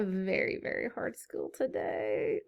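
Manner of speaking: spooky teacher tease; mock ominous. Mock dramatic warning to kids.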